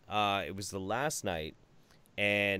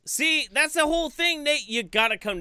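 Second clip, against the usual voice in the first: much higher, louder excited burst; excited tease; 5 dB louder than usual. Real, not acted. Excited burst while teasing Nate about VidCon.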